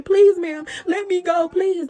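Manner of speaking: imitating the beaten neighbor begging to be released; imitated screaming. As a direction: beg to be let go, hammed up. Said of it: "You can hear her screaming".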